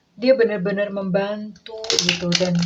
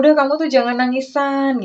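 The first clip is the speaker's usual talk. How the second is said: higher, louder comforting call; 5 semitones higher, 6 dB louder than usual. Retold or imitated. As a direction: urge them to stop crying. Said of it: "dia tuh suka ngomong". (menghibur dengan suara lantang)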